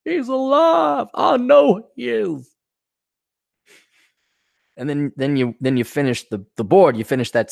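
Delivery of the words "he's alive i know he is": high, slow strained mock cry; hammed-up desperate insistence; melodramatic soldier. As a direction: wail it slowly like a bad war-game hero. Acted soldier refusing comrade's death.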